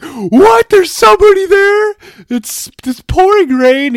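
amazed clerk calling out through the rain; surprised shout. Retold or imitated. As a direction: jump up in pitch like you can't believe someone's there. Guy shocked to see customer.

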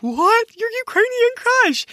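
high excited squeal. Retold or imitated. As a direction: gasp it out with delight. Sisters' excited reply.

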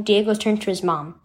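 usual speaking voice

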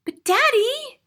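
high, loud protest; plead, cut short by Daddy; pleading child. A narrator's reading. Child pleading with angry father.

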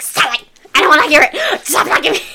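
loud frustrated snapping; angry yelling. Retold or imitated. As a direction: yell it like a stressed sister. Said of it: "that's a great reenactment".

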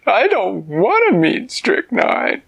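high, slow, stammering little bear voice; reluctant sad bear. Acted. Sad Bear balks at meeting Strychnine.

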